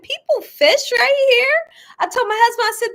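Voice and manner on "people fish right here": high, loud and slow, amazed disbelief; surprised. Retold or imitated. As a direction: ask it like you can't believe your eyes. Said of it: "I'm like".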